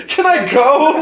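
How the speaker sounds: high, loud exasperated yell, laughing; mock yell. The fed-up customer yells at the liquor store clerk, laughing. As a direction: shout it at the clerk, half laughing. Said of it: "I must have yelled".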